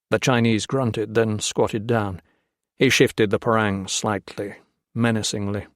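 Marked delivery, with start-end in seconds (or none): none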